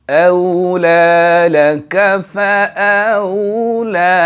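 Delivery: very slow high chant, voice sustained on each waw; Quranic recitation. Shown as a demonstration. Teacher recites the sakin waw example for viewers, chanted slowly. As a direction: stretch it out high and melodic. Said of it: "সাকিনের সময়".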